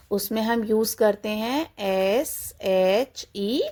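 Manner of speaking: ordinary delivery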